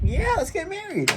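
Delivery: very high-pitched squealed acceptance; exaggerated high voice of excited girls; eager British girls. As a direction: squeal it in a high mock-girlish voice. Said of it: "they were both like".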